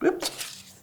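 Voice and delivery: normal talking voice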